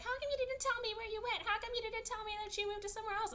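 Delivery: fast, very high nagging imitation; mock harassing. Woman tracking down her rescuer, mock high-pitched badgering. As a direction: pester him in a squeaky rapid-fire whine. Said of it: "harassing him and like".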